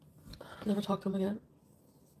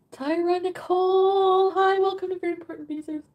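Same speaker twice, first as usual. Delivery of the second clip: higher, louder, drawn-out greeting; excited welcome; 10 semitones higher, 8 dB louder, slower than usual. Real, not acted. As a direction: sing out the viewer's name. Stream welcome call-out.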